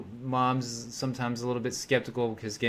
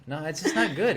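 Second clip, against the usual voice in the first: ordinary delivery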